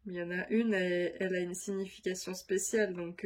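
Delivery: ordinary delivery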